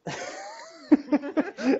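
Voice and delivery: high burst of laughter; real laugh. Real, not acted. Storyteller laughing at his own memory, high chuckle. Laughs while recalling the moment.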